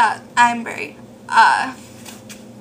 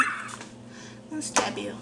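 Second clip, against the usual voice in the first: whispered threat; 5 semitones lower, 13 dB quieter than usual. Pretend.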